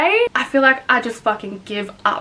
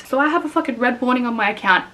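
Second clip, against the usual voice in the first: ordinary delivery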